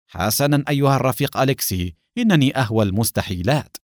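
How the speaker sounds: ordinary delivery, nothing marked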